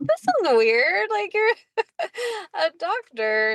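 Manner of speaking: high, incredulous retold reaction breaking into laughter; amused protest, pitched well up; laughing disbelief. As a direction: laugh through the words in mock bafflement.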